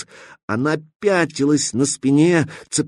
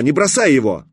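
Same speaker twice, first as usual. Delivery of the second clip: shouting; 2 semitones higher, 3 dB louder than usual. Acted. Katya yells at the stalker not to drop the container. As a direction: yell at him not to drop it. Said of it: "крикнула она".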